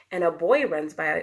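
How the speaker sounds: plain reading voice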